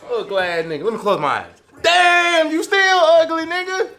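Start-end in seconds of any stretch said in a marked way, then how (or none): none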